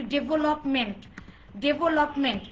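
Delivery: ডেভেলপমেন্ট with even, full syllables, DE-VO-LOP-MENT; common mispronunciation. Shown as a demonstration. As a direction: say ডেভেলপমেন্ট the common Bangladeshi way, each syllable equal. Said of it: "যেমন আমরা বলি". (ডেভেলপমেন্ট প্রচলিত ভুল উচ্চারণে, সমান জোরে)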